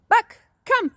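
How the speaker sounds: very high call to the dog far off. Real, not acted. Trainer calling Buck in from the yard, high ringing call. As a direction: sing out his name high.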